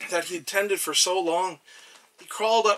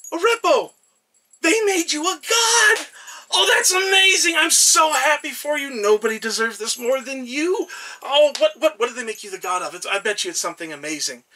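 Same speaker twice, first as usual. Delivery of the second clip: much higher and louder, joyful shouting; overjoyed shout; 7 semitones higher, 8 dB louder than usual. Acted. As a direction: burst out with delighted excitement. God overjoyed at friend's godhood.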